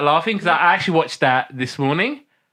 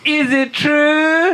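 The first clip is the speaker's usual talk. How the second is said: much higher, slow mock voices; catchphrase bit; 11 semitones higher, 3 dB louder, much slower than usual. Shown as a demonstration. Hosts trading the catchphrase, high slow voices.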